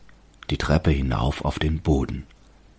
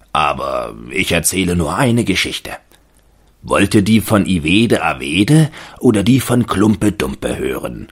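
erzählender Mann; 3 semitones higher, 5 dB louder than usual. A narrator's reading. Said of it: "sagte er".

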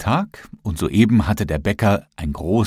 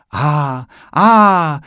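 admiring ah; 6 semitones higher, 6 dB louder than usual. A narrator's reading. Onlookers admiring in shop.

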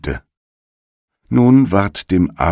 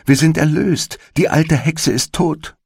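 much higher, excited relief; joyful cry; 13 semitones higher than usual. A narrator's reading. Gretel telling Hansel the witch is dead, thrilled and high. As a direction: announce their freedom with glee. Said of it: "und rief".

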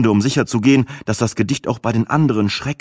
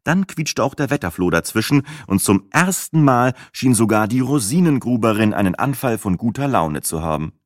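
plain reading voice

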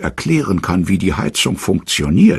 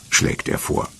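plain reading voice

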